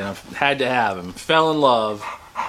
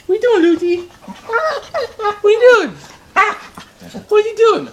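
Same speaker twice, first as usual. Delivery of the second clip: much higher, louder pet voice, drawn out; 5 dB louder, slower than usual. Real, not acted. Owner teasing her puppy Lucy in high louder baby talk. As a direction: tease the puppy in a high sing-song.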